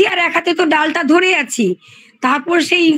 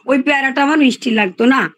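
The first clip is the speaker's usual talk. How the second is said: ordinary delivery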